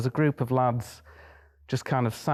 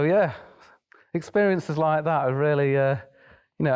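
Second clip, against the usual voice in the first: ordinary talk, nothing marked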